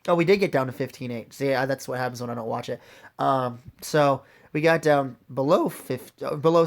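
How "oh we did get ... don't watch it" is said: ordinary talk, nothing marked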